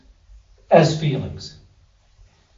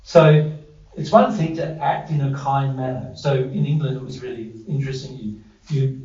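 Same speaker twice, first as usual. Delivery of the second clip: ordinary talk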